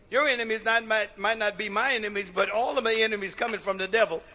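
ordinary delivery

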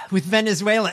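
mocking imitation of Venezuela; mock her delivery. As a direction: parrot her halting line. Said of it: "And a great relationship".

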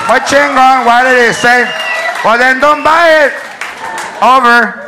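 loud, irritated Chicano intercom voice; angry Chicano announcement. Pretend. The announcer scolds a shopper over the intercom, angry Chicano accent. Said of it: "announcements have accents".

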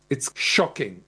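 ordinary talk

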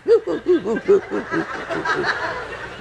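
high, hooting mock-evil cackle; demonic laugh. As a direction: imitate a prankster's demonic giggle. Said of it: "it's a very demonic laugh".

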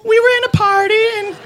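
put-on very high, giggly, tipsy girl's voice; a high, tipsy young woman makes excuses; high girly voice. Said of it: "she's like".

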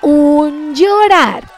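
un llorar stretched slowly like the elder aunts; old aunts' way. Retold or imitated. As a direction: lightly imitate the older aunts saying un llorar. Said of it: "Decimos ya las tías grandes".

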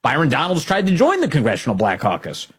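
usual speaking voice

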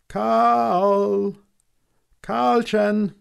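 put-on high, loud calls for a lost child; calling loudly. A narrator's reading. Searchers calling out loudly for the missing boy. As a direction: shout his name across the garden. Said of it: "we cried".

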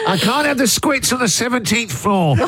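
hammed-up sick-day voice; fake sick call to the boss. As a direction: whine it like skiving off work. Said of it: "This is the voice you do when you call your boss".

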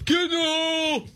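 high, drawn-out heckler's holler; mock golf-fan yell. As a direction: imitate a fan yelling at a tournament. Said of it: "anybody that yells".

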